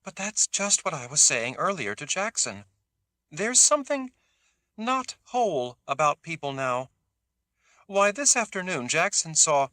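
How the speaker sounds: eager high rush of words. A narrator's reading. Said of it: "Teresa said eagerly".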